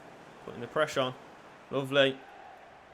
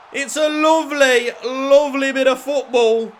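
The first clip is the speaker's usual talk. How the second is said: delighted; 7 semitones higher, 8 dB louder than usual. Real, not acted. Delighted after the goal.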